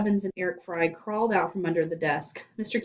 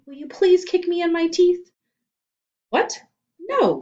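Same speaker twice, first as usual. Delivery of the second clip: shocked; 11 semitones higher, 8 dB louder than usual. A narrator's reading. Odd request and shocked refusal.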